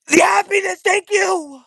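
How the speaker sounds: high, loud burst of joy; happy shout. Real, not acted. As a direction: shout your thanks out of pure happiness. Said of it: "I had to express the happiness".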